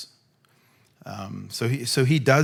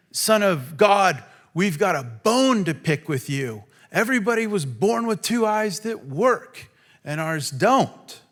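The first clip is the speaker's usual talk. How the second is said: mock grievance; 6 semitones higher than usual. Mock grievance of blind men.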